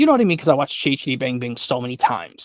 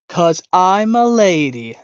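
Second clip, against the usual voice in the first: slow, drawn-out prissy kitten voice, a touch louder; prim girl kitten; 5 semitones higher, 5 dB louder, much slower than usual. Retold or imitated. Impressionist doing the girl kitten's line, slow and prissy. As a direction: stretch it out like a haughty little lady cat. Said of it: "Yep, from the Aristocats".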